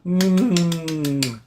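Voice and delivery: ordinary delivery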